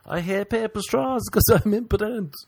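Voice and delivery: high-pitched comic complaint; mock sex cry. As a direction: blurt it high, as if mid-sex. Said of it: "that I'm gonna say during sex".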